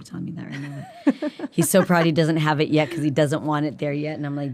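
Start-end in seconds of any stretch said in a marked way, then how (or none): 0.0-1.5 guilty whisper, mostly breath; whispered aside. Real, not acted. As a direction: drop to a whisper as if he might overhear. Aside about embarrassing her son.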